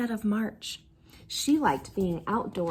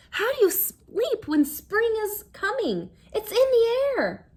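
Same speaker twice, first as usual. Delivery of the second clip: put-on excited girl; 10 semitones higher than usual. A narrator's reading. Phyllis excited about spring.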